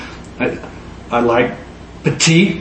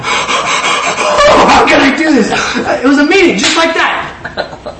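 gasping, slow and higher through laughter; laughing disbelief; 10 semitones higher, much slower than usual. Retold or imitated. Preacher picturing pageant women, laughing slowly in disbelief. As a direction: laugh as you ask it, overwhelmed. Laughing at his own vision.

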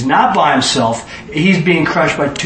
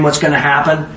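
usual speaking voice

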